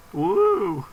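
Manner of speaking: excited cheer. Real, not acted. Cheering introduction.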